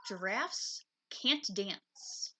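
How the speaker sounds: ordinary delivery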